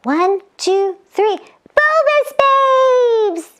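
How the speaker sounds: high drawn-out cheer. Shown as a demonstration. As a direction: lead the kids in the chant. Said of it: "You wanna try it".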